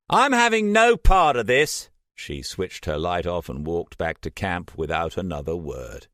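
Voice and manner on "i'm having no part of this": higher, louder bitter shout. A narrator's reading. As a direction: Trish lashing out at Jason. Trish storming off.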